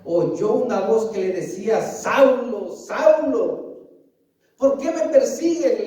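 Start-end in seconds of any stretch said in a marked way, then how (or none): 1.8-5.9 a calling voice from heaven, raised a little; voice of the Lord. Acted. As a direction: call out to Saul as the Lord, drawn out. Voice from heaven calls Saulo.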